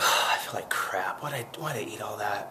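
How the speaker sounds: low, half-whispered, miserable morning voice; low, breathy regret the morning after eating a whole pizza; groggy morning groan. Pretend. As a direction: sound like you just woke up bloated. Waking up after overeating.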